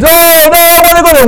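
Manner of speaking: very high, very loud hammed-up retort; mock defiant reply. Missionary acting the non-believer shouting back in role play. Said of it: "they shout".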